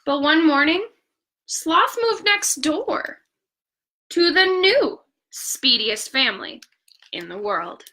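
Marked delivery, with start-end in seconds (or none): none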